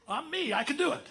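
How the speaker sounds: high, loud laughing boast. Real, not acted. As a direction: laugh through the brag. Joking about himself onstage.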